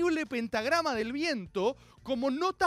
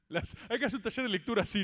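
usual speaking voice

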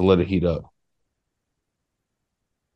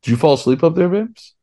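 raised voice calling up the stairs; calling upstairs; 9 semitones higher than usual. Real, not acted. Calling up to the girlfriend upstairs, raised and rising. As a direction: call up to her, pitch climbing high on babes.

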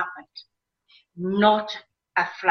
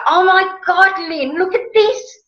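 much higher and louder, bursting with delight; excited exclaim; 10 semitones higher, 4 dB louder than usual. Retold or imitated. To her friend Lynn over the spice rack, a high excited cry. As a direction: gasp it out like a kid seeing presents. Thrilled in new kitchen.

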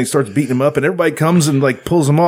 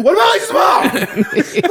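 high, slow, incredulous shout at the end credits; baffled shout; 11 semitones higher, slower than usual. Retold or imitated. Shouting at the screen as the credits roll, incredulous. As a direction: yell it out, stunned that nobody explains. Own baffled reaction to the film.

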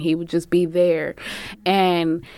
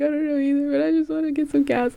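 pleading; 8 semitones higher, faster than usual. Retold or imitated. Retold pleading with the worker.

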